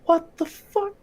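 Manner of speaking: high, breathy disbelief; a squeaky stunned exclamation; incredulous. Acted. Reacting to gash in wall.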